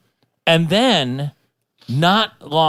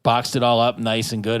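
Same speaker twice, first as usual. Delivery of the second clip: ordinary delivery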